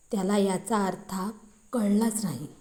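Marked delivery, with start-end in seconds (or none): none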